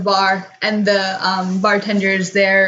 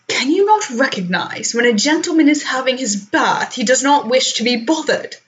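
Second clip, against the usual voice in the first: snooty cat; 5 semitones higher than usual. Acted. Cat gentleman voiced by GM.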